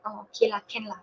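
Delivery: soft, shrugging Manglish; easygoing Manglish. Shown as a demonstration. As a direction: let it slide, no big deal. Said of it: "to say in Manglish, you'll feel very chincai".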